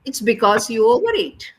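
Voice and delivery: ordinary talk, nothing marked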